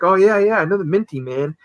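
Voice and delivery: high eager fan voice; lightly hammed-up excited fan. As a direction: gush like an eager fan who just spotted him. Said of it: "He's like".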